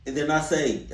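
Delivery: usual speaking voice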